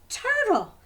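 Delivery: furious tiger calling out; exclaim. Acted. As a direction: cry out the name in shock. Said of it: "exclaimed Tiger".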